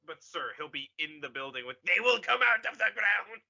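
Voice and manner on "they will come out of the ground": movie villain voice. Retold or imitated. Quoting movie villain line louder.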